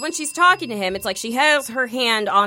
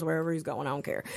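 low, much softer throwaway; dismissive aside; 6 semitones lower, 12 dB quieter than usual. Retold or imitated. Storyteller brushing past the pilot's reply, dismissive aside. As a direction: drop the voice and toss it off. Retold indifferent reply, dropped voice.

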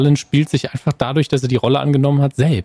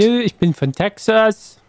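mock East German accent; 6 semitones higher than usual. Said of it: "so mit Ostakzent".

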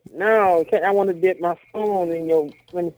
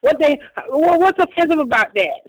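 incredulous outburst; 8 semitones higher than usual. Real, not acted. Defending the term to his cohost, high and indignant. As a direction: throw the words up high, outraged.

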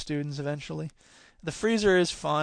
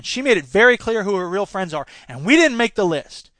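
raised, emphatic indignation building louder; angry insistence; 3 semitones higher, 7 dB louder than usual. Acted. Susan jabs a finger toward Karen, angrily insistent. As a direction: drive each point home, hurt and cross.

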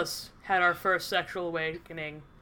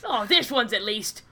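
mock Australian wildlife presenter; Steve Irwin; 3 dB louder, slower than usual. Co-host sizing up the passenger, hammed-up Australian accent. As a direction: size up a specimen in an Australian accent, drawing out the end. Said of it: "like having Steve Irwin drive me".

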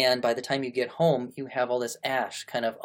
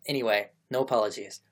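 usual speaking voice

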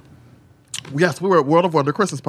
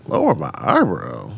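puzzled repeat; 8 semitones lower than usual. Retold or imitated.

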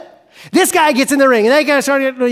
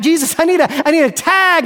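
pleading for a tag; 6 semitones higher, 3 dB louder, faster than usual. Acted. Acted plea for help.

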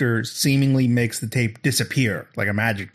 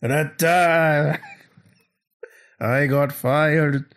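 slow, higher hammy showbiz delivery; showy stage entertainer; 4 semitones higher, much slower than usual. Pretend. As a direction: stretch each word for a mock stage act. Continues imagined one-man stage show.

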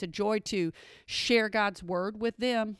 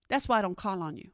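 ordinary delivery

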